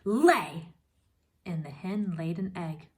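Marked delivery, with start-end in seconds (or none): 0.0-0.7 sharp loud command to the hen; barked order. Acted. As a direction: bark one word at the hen.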